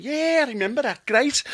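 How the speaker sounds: high-pitched pretend Liverpudlian; fake Scouse accent. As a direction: bluff along in a put-on Scouse voice. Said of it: "he realised that I wasn't a Scouser".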